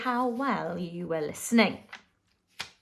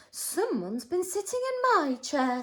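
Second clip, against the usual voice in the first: Mother Bear; 7 semitones higher, 5 dB quieter than usual. Acted. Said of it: "said Mother Bear".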